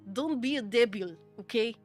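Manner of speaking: normal talking voice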